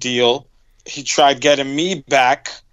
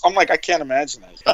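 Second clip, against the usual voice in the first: usual speaking voice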